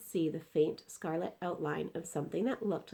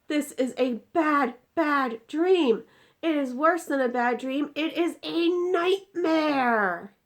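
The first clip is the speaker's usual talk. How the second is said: loud, high, slowing to a drawn-out end; dread-filled giant; 10 semitones higher, 10 dB louder, slower than usual. Acted. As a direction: build to nightmare. BFG dreading the nightmare.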